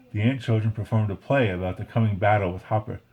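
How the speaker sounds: usual speaking voice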